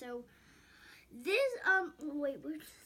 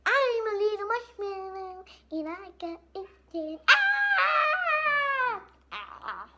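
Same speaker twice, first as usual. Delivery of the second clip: marshmallow scream; 10 semitones higher, 13 dB louder, much slower than usual. Pretend.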